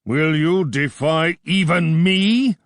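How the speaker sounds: furious king confronting Gordon; roaring king. A narrator's reading. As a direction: thunder it down at him. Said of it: "he roared".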